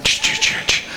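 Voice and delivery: unvoiced ch sounds; water flick noises. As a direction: imitate flicking water drops.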